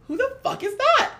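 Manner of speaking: high-pitched incredulous question, half laughing; retold incredulous squawk; baffled disbelief. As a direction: demand it in high, amused bafflement. Retelling her baffled reply.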